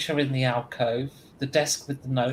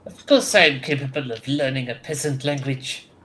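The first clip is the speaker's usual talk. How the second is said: haughty officer in a fake French accent; proud, offended, fake French accent. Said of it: "that was my fake French accent".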